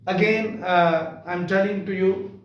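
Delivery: ordinary talk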